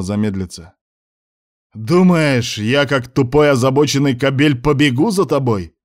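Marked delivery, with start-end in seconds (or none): 1.7-5.8 higher, louder yell. Acted. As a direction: yell it after her. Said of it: "заорал Муса".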